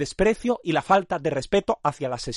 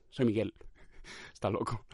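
very soft, low undertone; hushed, as if to oneself; muttered aside; 7 semitones lower, 13 dB quieter than usual. Pretend. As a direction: drop to a private mumble. Muttered asides after pleading aloud.

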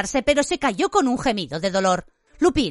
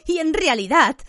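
higher, betrayed accusation; hurt cry at raised pitch; faltering shout; 8 semitones higher, 3 dB louder than usual. A narrator's reading. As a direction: accuse him, voice giving way. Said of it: "gritó a Lupin flaqueándole la voz".

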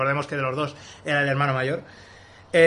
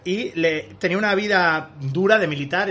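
ordinary talk

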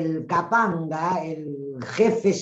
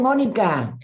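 ordinary talk, nothing marked

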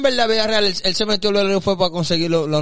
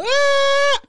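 high, sustained mock wail; exaggerated high crying noise; imitated crying wail. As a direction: wail like an aunt at a wake. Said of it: "una tía que está llorando".

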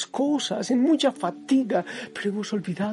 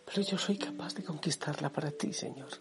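soft, lower whisper of resolve; hushed determined vow, softer and lower; 5 semitones lower, 10 dB quieter than usual. Retold or imitated. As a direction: breathe the promise quietly. Old Caleb's vow to God.

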